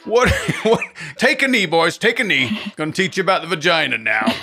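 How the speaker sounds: high, barking coach voice; football coach. Pretend. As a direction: shout orders at the team. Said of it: "the football coach was asked to teach".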